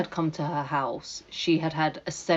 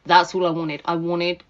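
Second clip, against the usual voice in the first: normal talking voice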